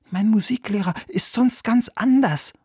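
high boy's voice in a mock whisper; boy confiding. A narrator's reading. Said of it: "flüsterte Oliver Bertha zu". (Junge, vertraulich, mit hoher Stimme)

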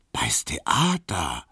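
slow, whispered echo of "bei's Theater"; awed whisper. Acted. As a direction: breathe "bei's Theater" slowly in hushed awe. Piesecke echoes, awed.

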